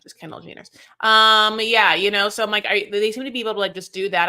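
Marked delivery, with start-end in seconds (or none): 0.0-0.7 a catty little whisper under the breath; whispered aside. Real, not acted. Gossiping to her co-host about a celebrity tequila, whispered. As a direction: whisper it as a sly dig.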